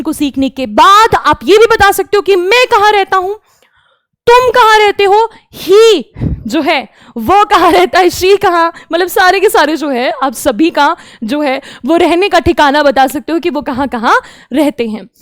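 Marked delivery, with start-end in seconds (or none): none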